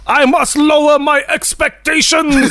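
high, slow mock-wizard proclamation; Wizard Shazam. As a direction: declaim it grandly, drawn out and high-pitched. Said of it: "Wizard Shazam goes".

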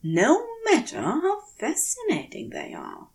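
a gentle, mock-stern telling-off to himself; a hint of a fussy gentleman chiding himself; Aziraphale scolding himself. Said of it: "he tells himself".

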